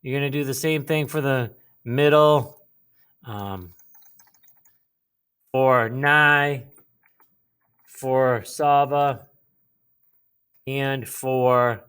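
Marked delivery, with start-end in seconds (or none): none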